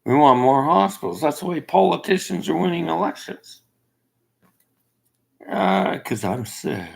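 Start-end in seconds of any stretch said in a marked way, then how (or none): none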